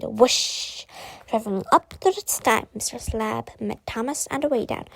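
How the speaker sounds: ordinary delivery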